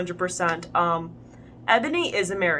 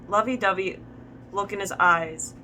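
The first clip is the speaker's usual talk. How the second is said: usual speaking voice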